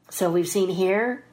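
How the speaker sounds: ordinary delivery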